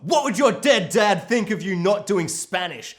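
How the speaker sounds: exaggerated outrage; mock angry rant. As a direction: rant at an unreasonable teacher, hammed up. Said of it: "the angriest teacher I've ever seen".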